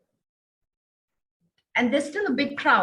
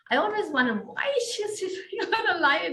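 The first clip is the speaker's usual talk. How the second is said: a puzzled question with a real laugh breaking through it; laughing wonder; 9 semitones higher than usual. Real, not acted. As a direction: ask it half-laughing, amused at the idea. Amused wondering about a lion.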